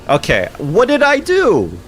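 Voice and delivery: high, loud baffled yell; exasperated shout. Real, not acted. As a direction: yell it at the ceiling in disbelief. Baffled by TikTok penalty.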